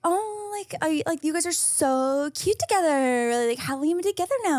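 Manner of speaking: high, gushing fake-nice; mock sweet voice. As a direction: fake delight. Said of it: "And so I was like".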